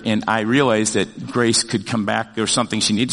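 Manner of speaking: ordinary talk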